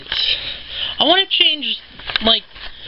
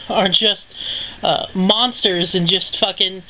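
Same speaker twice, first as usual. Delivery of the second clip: usual speaking voice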